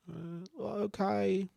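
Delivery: put-on timid little kid. Four-year-old picking his nose answers the trainer, meek. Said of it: "the kids are like".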